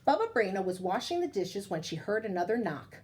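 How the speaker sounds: ordinary delivery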